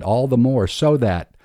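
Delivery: usual speaking voice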